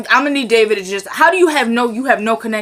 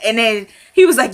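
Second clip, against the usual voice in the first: usual speaking voice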